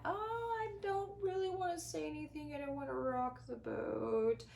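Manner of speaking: high, soft, hesitant mock voice of a pleaser; timid people pleaser. Host mimics a people pleaser who avoids rocking the boat, high and soft. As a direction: lightly mimic someone afraid to speak up.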